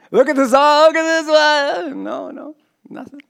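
loud, high-pitched gawking; mock amazement. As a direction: exclaim like an awestruck onlooker. Mimics gawkers at signs and wonders.

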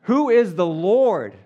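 loud, slow, haughty demand; proud Pharaoh. Retold or imitated. Preacher reading Pharaoh's defiant reply loud and slow. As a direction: boom it like a defiant king. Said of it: "he would say".

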